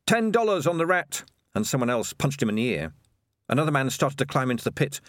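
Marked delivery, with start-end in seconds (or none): 0.1-1.2 high-pitched call from the crowd; mild mock shout. A narrator's reading. Watcher betting on the rat, mild shout. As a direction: call out a bet without full volume. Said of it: "Someone shouted".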